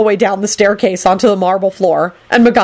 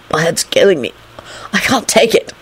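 pained, weary complaint; moaning. A narrator's reading. Injured Lupin with head in hands, moaning. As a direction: groan about a sore head. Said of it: "Lupin moaned".

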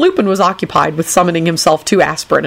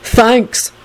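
higher, mock-grateful; sarcastic; 6 semitones higher than usual. A narrator's reading. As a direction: thank them with obvious sarcasm. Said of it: "Neville replied sarcastically".

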